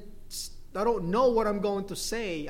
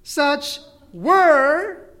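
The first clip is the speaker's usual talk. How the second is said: "were" drawn out, high and loud; stress on WERE; 8 semitones higher, 14 dB louder than usual. Shown as a demonstration. Preacher stressing the past tense, stress on "were". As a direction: punch "were" long and very loud.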